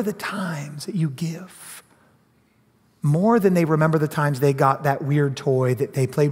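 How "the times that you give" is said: hushed, breathy aside to the congregation; soft sermon whisper. Real, not acted. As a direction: drop to a whisper to draw them in. Pastor drops to a whisper.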